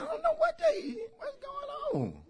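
high, soft innocent denial; innocent son. Retold or imitated. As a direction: play the boy feigning innocence to mama. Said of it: "I said".

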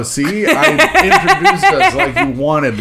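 high, slow, laughing through the words; breaking into laughter mid-line. Real, not acted.

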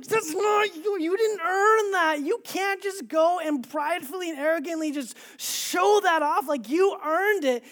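high, loud retold scolding; furious inner rant. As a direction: scold in exasperation, acting out pent-up anger.

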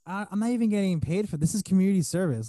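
exasperated protest. Retold or imitated. Unpaid helper protesting to the complaining mom, exasperated.

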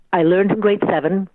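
ordinary talk, nothing marked

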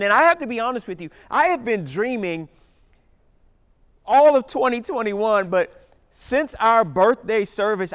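loud, fervent preaching. Real, not acted. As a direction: preach it with rising fire. Full-force preaching.

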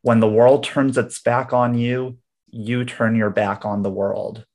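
slow, deliberate quote; slow clue reading. Shown as a demonstration. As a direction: read the line slowly and clearly. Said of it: "Here we go".

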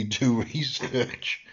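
words breaking into a chuckle; chuckling. Real, not acted. Podcaster doubting listeners will bother, chuckling. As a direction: laugh lightly through the words.

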